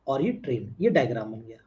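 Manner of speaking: usual speaking voice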